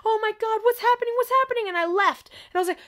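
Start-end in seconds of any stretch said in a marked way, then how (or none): 0.0-1.7 high, loud panicked questions; retold panic. Reliving the night it happened, high panicked cry. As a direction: act out alarm, pitched up and loud.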